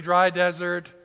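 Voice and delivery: normal talking voice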